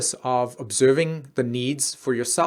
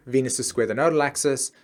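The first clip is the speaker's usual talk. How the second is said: ordinary talk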